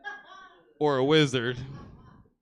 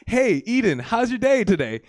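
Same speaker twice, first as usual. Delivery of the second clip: higher, louder cheerful parent voice; talking to toddler; 7 semitones higher, 7 dB louder than usual. Retold or imitated. Father greeting his little son, higher and louder. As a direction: ask it like a bright parent.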